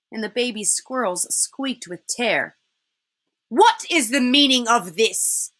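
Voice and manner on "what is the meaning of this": lightly voiced imperious witch; demanding witch. Witch confronts the party.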